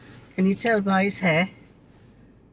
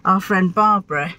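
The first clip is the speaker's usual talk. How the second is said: ordinary delivery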